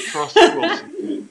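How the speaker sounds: high laughing repeat of the line. Real, not acted. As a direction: break into a laugh mid-line.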